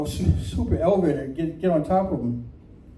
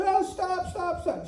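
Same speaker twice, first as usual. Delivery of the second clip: high, held-back imitation of yelling; mock yelling; 11 semitones higher, 7 dB quieter, slower than usual. Horse owner yells after bolting horse, high mock yell. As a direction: cry out high without real volume. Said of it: "She's yelling to him".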